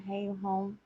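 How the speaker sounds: normal talking voice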